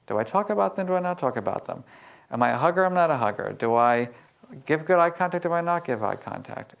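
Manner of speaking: normal talking voice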